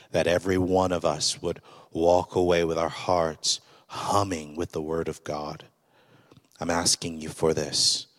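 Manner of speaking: loud preacher's prayer, pressing; fervent prayer. Acted. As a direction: pray aloud over the congregation, with fervour. Opening prayer from the pulpit.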